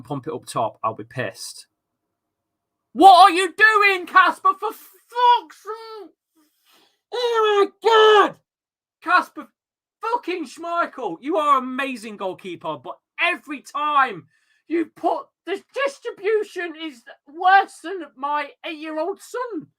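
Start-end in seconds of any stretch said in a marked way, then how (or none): 2.9-8.4 high, loud, slow exasperated shouting; furious outburst. Real, not acted. Keeper gives away the ball. 9.0-19.7 high-pitched furious tirade; exasperated rant. Real, not acted. Ranting at his own keeper.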